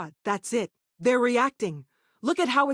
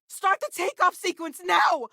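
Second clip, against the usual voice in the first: higher, urgent commands to the crew; urgent shout; 9 semitones higher than usual. Acted. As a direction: yell it as the ship must launch. Urgent orders during an escape.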